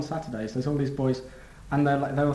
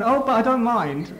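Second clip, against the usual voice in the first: eager reply; 5 semitones higher, 7 dB louder, slower than usual. Retold or imitated. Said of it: "And I went".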